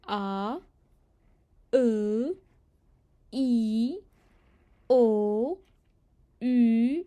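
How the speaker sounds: आ, ए, ई, ओ, यू each said slowly and separately; a French teacher's vowel drill; reading the French vowels aloud. Shown as a demonstration. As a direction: spell out the vowels one by one, loud and clear. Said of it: "एक बार फिर पढ़ के सुना देती हूँ".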